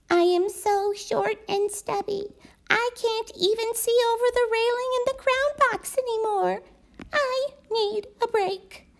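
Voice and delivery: high, small voice of a stubby blue crayon; a little high and weary, asking for a break; worn-out blue crayon. Acted. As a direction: pipe up like a tiny worn-down crayon. Blue crayon's tired letter.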